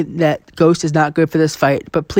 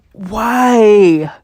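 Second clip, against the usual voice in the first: louder, higher anguished why; exasperated why; 5 semitones higher, 4 dB louder than usual. Real, not acted. Player lamenting his lost redemption fight, louder anguished cry. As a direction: cry out at the unfairness.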